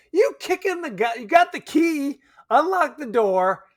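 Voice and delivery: high, urgent acting-out; excited. Imagining breaking in to check on the old woman, excited. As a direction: charge in, voice rising.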